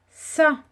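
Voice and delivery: Sa said as one clear syllable; sounding out words. Shown as a demonstration. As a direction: sound out Sa on its own. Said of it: "syllabe par syllabe".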